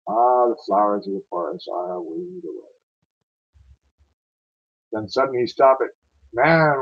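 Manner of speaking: rolling, dramatic ballad reading; a Scots war poem read aloud with feeling; poem recitation. Acted.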